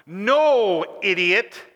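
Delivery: loud, blunt "No, idiot"; crass retort. Retold or imitated. Paul answering the sinners, loud blunt rebuke. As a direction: bark it like an exasperated apostle. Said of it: "this might be a bit crass".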